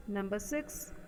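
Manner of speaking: ordinary talk, nothing marked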